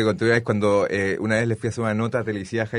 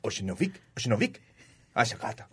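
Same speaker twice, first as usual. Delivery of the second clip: fake foreign language; 10 dB quieter than usual. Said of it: "Hicimos toda la nota en lituano".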